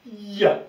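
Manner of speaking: long Y glide into a in "ya", high and loud. Shown as a demonstration. Teacher reading the Y with the A, stretched glide. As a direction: stretch the Y of "ya" and release loudly. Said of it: "je vais le lire avec le son".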